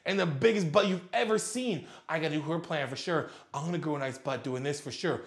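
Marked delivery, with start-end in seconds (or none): 2.1-5.2 lower, softer mock follower voice; a hint of a dim follower. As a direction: parody a gullible follower muttering. Mocking a follower.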